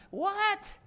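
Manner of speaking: sudden high startled "what?"; surprised what. Real, not acted. Preacher interrupting his own list, startled. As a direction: break off in mock disbelief. Aside while listing idol worship.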